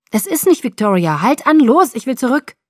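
high, loud, pressing demand; urgent shouting. A narrator's reading. Bella demanding that Jacob turn the car back, urgent shout. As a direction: build to a loud order.

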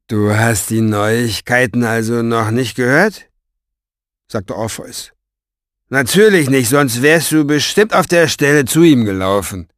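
gloating villain, rising to a loud, lively taunt; sneering Orpheus. Acted. Orpheus taunts his captive about news he missed, gloating.

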